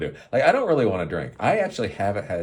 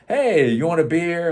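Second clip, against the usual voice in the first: mock friend offer; 10 semitones higher, 6 dB louder than usual.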